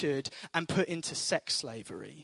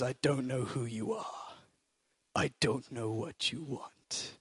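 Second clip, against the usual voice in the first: much lower, softer, menacing phone threat; Liam Neeson; 5 semitones lower, 6 dB quieter than usual. Retold or imitated. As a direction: threaten the kidnapper in a hushed, deadly calm. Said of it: "I should have been an actor".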